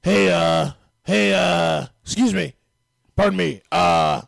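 very loud, raspy caricature. Shown as a demonstration. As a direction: force out a loud, raspy poor-guy voice.

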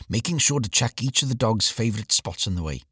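ordinary delivery

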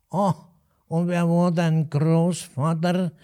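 put-on old innkeeper's drawn-out Hessian drawl; slow nasal Alsfeld dialect. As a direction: stretch each word slowly through the nose. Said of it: "nasale Aussprache und ganz langsam".

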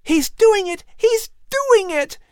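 put-on very high, thrilled boy's cry; excited exclamation. A narrator's reading. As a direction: exclaim in amazement, voice shooting up. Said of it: "Patrick exclaimed".

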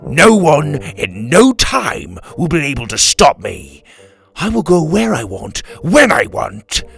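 loud, slow villain's declaration; pirate captain boasting angrily; scowling defiance. A narrator's reading. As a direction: raise the voice, hammering out each phrase. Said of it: "He turned back and scowled".

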